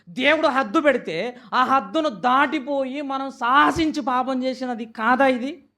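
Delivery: loud, rising sermon cadence, pushing each question; full-force preaching. Acted. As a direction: hammer the congregation with each ఎన్నిసార్లు. (గట్టిగా, ఉద్వేగంగా బోధించే స్వరం)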